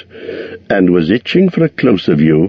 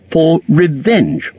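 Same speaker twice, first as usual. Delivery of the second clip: slow, drawn-out revenge from the small hobbit; a hobbit trying to sound bold; lightly defiant hobbit; Bilbo declaring revenge; 9 semitones higher, slower than usual. A narrator's reading. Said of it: "to keep his end up".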